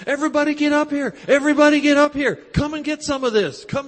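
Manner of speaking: high, fast, loud calling to everyone; rally the whole church forward; imitating the pastor calling out in excitement; excited shout. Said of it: "running up and down the aisles going".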